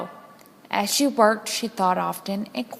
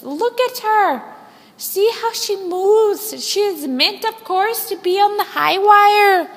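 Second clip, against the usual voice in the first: French aerialist; 10 semitones higher, 4 dB louder than usual. Acted. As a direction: admire the hen like a French star. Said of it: "said Mitzi the aerialist".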